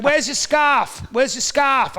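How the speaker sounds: loud, high, drawn-out holler; woman calling out. Retold or imitated. Woman hollering at a freezing man, loud and high. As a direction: holler it slowly at a passer-by. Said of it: "the woman was like".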